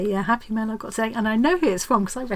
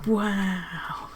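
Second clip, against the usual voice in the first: whispered wow; 2 semitones lower than usual. Real, not acted. Whispering to viewers, amazed by the book. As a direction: breathe it out in wonder.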